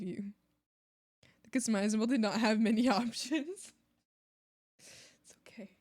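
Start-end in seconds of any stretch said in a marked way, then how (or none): 4.8-5.7 soft unvoiced it's okay; whispered reassurance. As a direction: whisper it gently to calm them. Soothing whisper to viewer.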